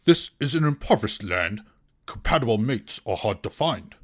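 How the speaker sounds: ordinary talk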